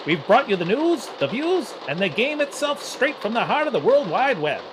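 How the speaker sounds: high, loud transatlantic newsreel announcer; old newsreel. Acted. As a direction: boom it out like a 1940s broadcast. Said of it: "in the style of, like, a nineteen forties newsreel".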